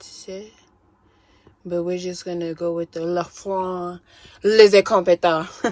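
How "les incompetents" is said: loud hammed-up French "les incompetents"; movie quote, mock French. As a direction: bark the movie line in French. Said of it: "You're what the French calls".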